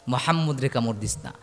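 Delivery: soft, low, unchanted delivery; low repeated warning. Acted. Preacher repeating the warning not to bite, low and soft. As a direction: drop the voice and state it simply.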